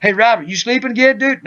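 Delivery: high, quick mock call; acted-out wake-up call; calling to sleeper.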